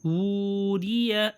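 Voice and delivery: ووریہ read slowly with a long oo; slow reading demo. Shown as a demonstration. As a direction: stretch ووریہ for the class. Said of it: "standing ضمہ".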